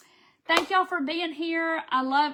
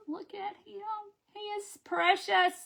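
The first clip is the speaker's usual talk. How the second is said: put-on cooing baby talk; 8 semitones higher than usual. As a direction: coo at him like a sweet baby. Cooing over the snowman craft.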